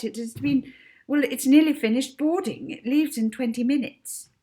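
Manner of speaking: high, hurried apologetic clerk; dazzled clerk suddenly deferential; flustered clerk. A narrator's reading.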